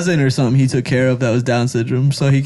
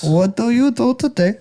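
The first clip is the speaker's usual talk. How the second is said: raised mock Indian accent; 6 semitones higher than usual. Shown as a demonstration. Friend attempting an Indian accent after a challenge, mock accent. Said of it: "you can't do an Indian accent".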